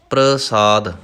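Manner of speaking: ਪ੍ਰਸਾਦ with a plain s sound, not sh; correct pronunciation. Shown as a demonstration. Teacher showing students the correct ਪ੍ਰਸਾਦ, careful and clear. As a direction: say ਪ੍ਰਸਾਦ with a clean s, half s before r. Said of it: "ਨਹੀਂ ਕਹਿਣਾ".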